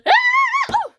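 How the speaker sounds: very high, loud squeal; high-pitched scream. Acted. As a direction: shriek on one sustained high note. Scout screams at lost powers.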